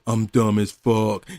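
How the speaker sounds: low, slow flat admission; deadpan self-insult. Acted. Host mocking the coach, slow deadpan low voice. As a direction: drop it low and slow like a confession. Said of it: "look in the mirror and just say".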